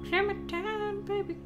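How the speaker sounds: put-on high sing-song call for a lost child; playful high-pitched calling; mock calling out. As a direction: call her name like a worried parent.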